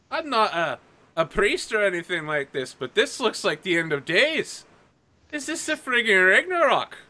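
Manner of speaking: Irish lilt that peaks loud and high on the last question; Irish accent. Acted. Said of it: "apparently I turned Irish this episode".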